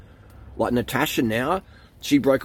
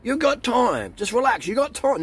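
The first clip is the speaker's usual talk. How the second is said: much higher, breezy mock-reassuring voice; Gary Vee; 10 semitones higher than usual. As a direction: mimic a laid-back guru brushing off worry. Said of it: "Gary Vee says".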